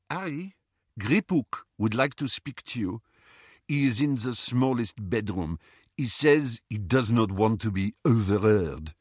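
put-on French-accented messenger; Fleur, French accent. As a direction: deliver the message in a French accent. Voices Fleur in the story.

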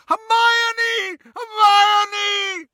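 high, anguished screams; shouting. A narrator's reading. Ron crying out to tortured Hermione, shouting. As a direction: yell the name desperately. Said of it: "Ron stopped shouting".

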